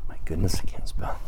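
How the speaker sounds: unvoiced self-deprecating whisper; whispered aside. Real, not acted. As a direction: mutter it to yourself under the breath.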